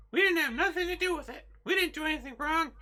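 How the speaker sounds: very high, loud, squeaky rat voice; a tied-up were rat squeals at the guards, frantic and very high; squealing were rat. Acted. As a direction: squeal it like a panicked trapped rat. Bound were rat protests.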